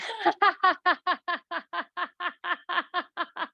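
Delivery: long, high, rhythmic ha-ha-ha; out-loud exercise laugh. Shown as a demonstration. Laughter teacher leading the class, sustained out-loud laugh. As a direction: keep a deliberate belly laugh rolling. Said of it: "And laugh out loud".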